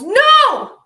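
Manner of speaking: high, loud, drawn-out yell; sudden yell. Retold or imitated. Friend stopping someone about to drink from a bottle, imitated yell. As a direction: imitate a friend yelling out in alarm. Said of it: "she yells".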